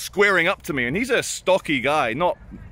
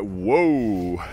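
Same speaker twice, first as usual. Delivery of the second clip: low, long "whoa"; low-pitched acted astonishment; mock taken aback; 6 semitones lower than usual. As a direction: draw it out as if backing off. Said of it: "I'm like".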